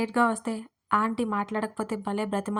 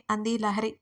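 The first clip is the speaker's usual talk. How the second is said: usual speaking voice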